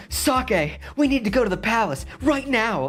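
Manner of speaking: high mock anime hero voice; hammed-up anime character line. A friend mocks anime dubs with a high hero voice. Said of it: "I don't know what words they say".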